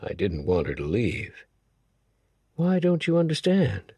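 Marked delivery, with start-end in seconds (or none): 2.6-3.9 higher woman's voice; Samandi's voice. A narrator's reading. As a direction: voice her, higher and curious. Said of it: "she asked".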